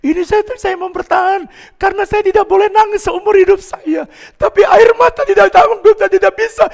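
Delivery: very high, loud mock wailing; imitating tearful outburst. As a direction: act out a big man breaking down, voice way up. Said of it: "Kenapa Bapak tiba-tiba nangis?".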